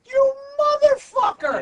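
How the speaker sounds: furious yell. Retold or imitated. Retelling the guy's outburst at Opie, screamed curse. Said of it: "and he goes".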